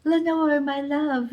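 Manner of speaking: high-pitched mock swooning; mock lovestruck teen. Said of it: "every time he was like".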